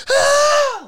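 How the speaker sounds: very high, loud sustained scream; piercing mock scream of a car full of people; imitated scream. Storyteller imitates the scream heard in the canyon, screaming. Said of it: "it literally sounded like".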